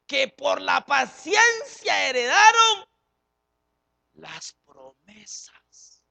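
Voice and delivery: tearful preaching. Acted. Preacher stretches a key phrase.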